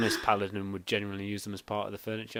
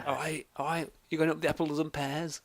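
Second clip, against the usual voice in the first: fake Cockney accent; 7 semitones higher than usual. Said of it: "gutted that he didn't have a fake Cockney accent".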